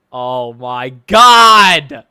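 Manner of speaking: long drawn-out, very loud groan of words; exasperated yell. Real, not acted. Reacting after regretting a click, exasperated yell. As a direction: build to a loud, stretched cry.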